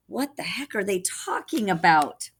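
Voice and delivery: plain reading voice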